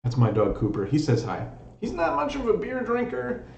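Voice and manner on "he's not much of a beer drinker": a high, playful voice for the dog; lightly voiced dog owner joke, high. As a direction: squeak it out like speaking for your pet.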